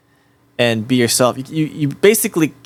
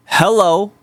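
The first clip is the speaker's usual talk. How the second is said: cheerful hello; 9 semitones higher than usual. Shown as a demonstration. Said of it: "Say it, say it happily".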